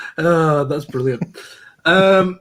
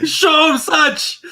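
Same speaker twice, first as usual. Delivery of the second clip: mock outrage; 12 semitones higher, 4 dB louder, much slower than usual. Real, not acted.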